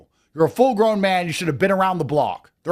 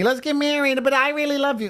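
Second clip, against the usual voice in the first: high, sing-song woman's voice; mocking women's plea; 8 semitones higher than usual. Women pleading with him to marry them, mocked high. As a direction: parody a clingy woman proposing. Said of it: "how many women say to me".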